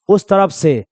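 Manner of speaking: usual speaking voice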